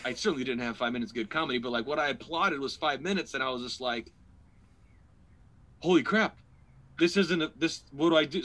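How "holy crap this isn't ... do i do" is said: high, loud retold panic; stammering alarm, pitched up; stressed out. Comic facing a cut set time, loudly stressed. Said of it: "so I'm stressed out even more".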